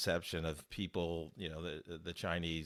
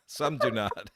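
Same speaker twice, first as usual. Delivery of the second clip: chuckling through the words; amused, laughing aside; 2 semitones higher, 4 dB louder than usual. Real, not acted. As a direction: laugh it off as a joke. Host laughs at his own joke.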